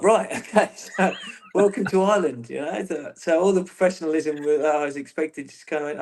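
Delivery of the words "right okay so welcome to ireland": higher-pitched, laughing retold reply; amused retelling. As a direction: laugh through the quoted reaction. Amused retelling of Jack's welcome.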